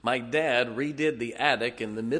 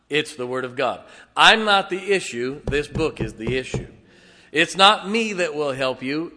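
loud, emphatic pulpit preaching; preaching at full force; 3 semitones higher, 7 dB louder than usual. Acted. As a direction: drive each line home from the pulpit. Preacher building to emphatic climax.

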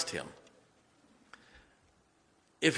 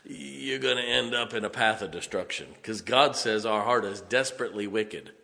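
ordinary talk, nothing marked

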